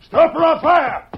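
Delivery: loud, high, slow command to halt; shouted warning. Acted. Marshal yells at a running shadow, loud halting command. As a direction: bark the order at the fleeing rider. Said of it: "He shouted".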